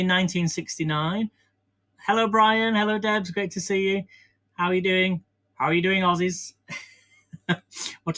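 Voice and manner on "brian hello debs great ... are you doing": ordinary talk, nothing marked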